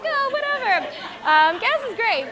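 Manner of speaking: high, loud, laughing brush-off; breezy dismissal. Retold or imitated. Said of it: "I'm like".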